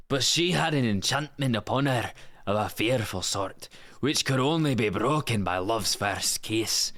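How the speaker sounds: lilting Scottish storybook narration; full-on Scottish accent. Acted. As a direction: read the fairy tale as a full-on Scot. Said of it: "Just like a full-on Scot".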